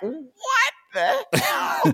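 high, stunned mock squeal; mock shock. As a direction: gasp it in disbelief. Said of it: "and you're like".

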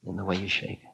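a hushed repeat for the listener to absorb; soft echo. Shown as a demonstration. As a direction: drop the volume and let "way" sink in quietly.

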